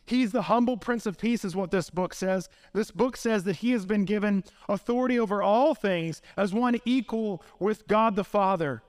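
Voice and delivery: ordinary delivery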